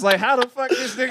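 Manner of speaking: laughing, amazed; laughing disbelief. Retold or imitated.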